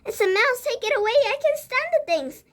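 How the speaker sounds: high, loud shrieking panic; frightened yelling. Acted. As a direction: scream it out at the mouse.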